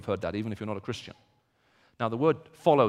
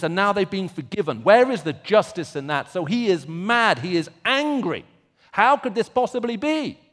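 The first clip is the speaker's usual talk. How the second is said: loud, rising preacher's fervour on Jonah's outrage; impassioned preaching; 8 semitones higher, 10 dB louder than usual. Acted. Preacher to congregation on Jonah's rage, loud and fervent. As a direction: build the outrage, pressing hard.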